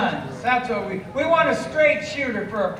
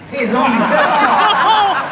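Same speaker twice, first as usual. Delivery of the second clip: laughing; 2 semitones higher than usual. Acted. Joking about Napoleon's height.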